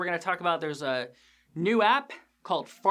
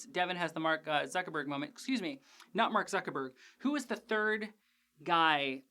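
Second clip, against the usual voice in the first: ordinary delivery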